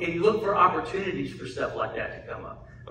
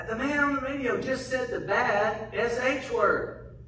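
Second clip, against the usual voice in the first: put-on higher, childlike tattling tone; little girl voice; 7 semitones higher than usual. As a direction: play the shocked little daughter telling on the radio. Daughter voiced in car.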